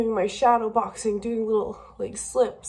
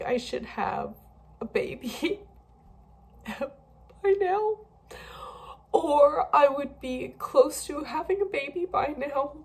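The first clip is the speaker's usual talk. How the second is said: tearful, breaking, pitched-up voice; real crying; 6 semitones higher than usual. Real, not acted. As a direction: voice cracking and jumping high through tears. Crying about miscarriages.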